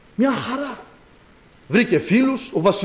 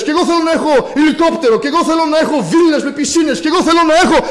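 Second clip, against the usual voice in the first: mocking whine; 9 semitones higher, 3 dB louder, faster than usual. Preacher mocks worldly wishes.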